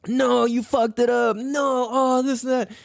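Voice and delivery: high-pitched mock outcry; worked-up protest. Acting out his upset viewer reaction.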